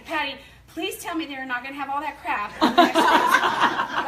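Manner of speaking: laughing plea. Retold or imitated. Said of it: "I'm texting Patty".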